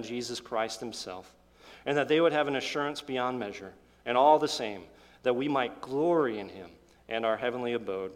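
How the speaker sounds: hushed, earnest pastoral prayer voice. Acted. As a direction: pray humbly over the congregation. Pastor prays before sermon.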